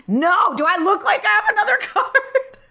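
high-pitched comic outrage; exaggerated indignant comeback; mock indignation. Acting out declined-card indignation.

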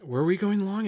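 ordinary delivery

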